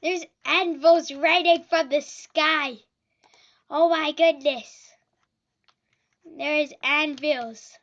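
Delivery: loud frantic exclamations; panicked shouting. Real, not acted. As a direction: yell in frantic disbelief. Anvils raining in game.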